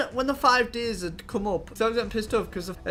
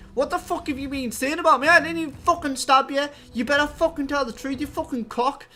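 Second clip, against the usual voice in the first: higher, louder furious yelling at the liar; angry confrontation; 7 semitones higher, 6 dB louder than usual. Retold or imitated. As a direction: tear into the kid who grassed on you. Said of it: "proper pissed off at him".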